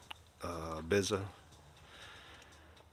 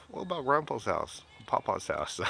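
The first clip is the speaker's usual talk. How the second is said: high, playful mock-offended question; mock surprise; 6 semitones higher than usual. Grandfather teasing his grandson with mock surprise. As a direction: lightly act hurt that grandpa got skipped. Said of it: "And it's like".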